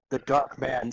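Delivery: ordinary delivery, nothing marked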